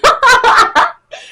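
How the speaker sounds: high, loud burst of laughter; real laughing fit. Real, not acted. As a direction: crack up helplessly. Cracks up telling it.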